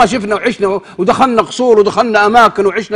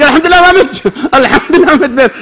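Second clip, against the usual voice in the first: laughing through a quoted thanks, higher and louder; laughing relief; 7 semitones higher, 4 dB louder than usual. Retold or imitated. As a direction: retell his relief while laughing. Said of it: "قال". (يضحك وهو يحكي فرحة صاحبه بالنجاة)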